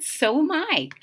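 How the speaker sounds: plain reading voice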